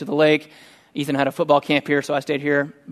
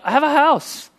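delighted realization; 11 semitones higher than usual. Retold or imitated. Sudden grateful realization.

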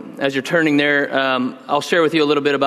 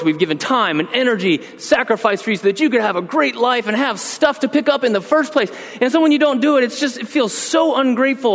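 higher, rising exasperation; worked-up dad; 9 semitones higher than usual. Retold or imitated. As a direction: pour out a father's hurt frustration, much higher. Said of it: "I'm all worked up by this point".

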